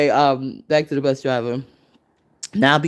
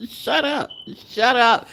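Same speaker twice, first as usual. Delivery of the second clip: incredulous shut up; 9 semitones higher, slower than usual. Pretend. Mocking a critic, high incredulous shut up. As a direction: fling it back in disbelief. Mock outburst.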